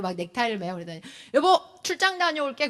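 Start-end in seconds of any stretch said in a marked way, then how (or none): 1.3-2.7 higher pretend-husband voice; child playing dad. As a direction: announce it like a kid playing daddy. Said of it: "또 막 저를 또 엄마 하라고".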